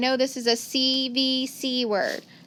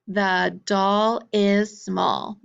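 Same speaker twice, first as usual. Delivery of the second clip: The doll is small stretched very slowly in one run; slow choral model; 4 dB louder, much slower than usual. Shown as a demonstration. Teacher saying it slowly together with the class. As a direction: drawl it out slowly for the class to copy. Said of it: "let's say that together".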